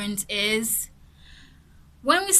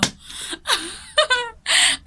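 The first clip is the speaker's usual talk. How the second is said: laughing; 12 semitones higher, 6 dB louder than usual. Real, not acted. Laughs after joke.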